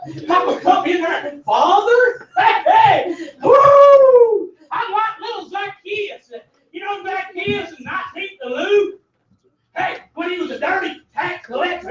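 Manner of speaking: high, shouted preaching; full-force preaching. Real, not acted. Preacher shouting to the congregation about Zacchaeus. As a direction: preach it up to the rafters.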